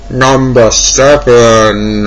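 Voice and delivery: slow, drawn-out title call; booming countdown announcer; dramatic announcer. Acted. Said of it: "countdown title".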